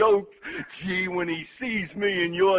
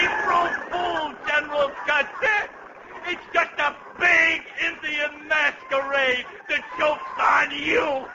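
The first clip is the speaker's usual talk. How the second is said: gleeful shouting; 4 semitones higher than usual. Acted. Aide shouts at General Custer, gloating over the joke. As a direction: crow over the prank.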